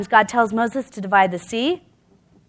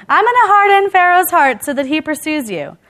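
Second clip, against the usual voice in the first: loud, raised voice of God; higher, forceful divine announcement; God speaking to Moses; 6 semitones higher, 7 dB louder than usual. Acted. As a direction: proclaim it emphatically, as God would. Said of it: "this is what God tells Moses".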